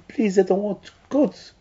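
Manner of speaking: high, pleading voice, slowing at the end; pleading hand. Pretend. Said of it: "And the hand is screaming".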